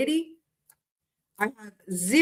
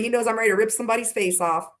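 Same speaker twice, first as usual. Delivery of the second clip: ordinary delivery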